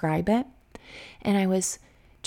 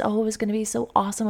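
ordinary talk

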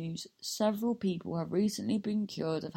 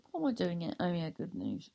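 ordinary talk

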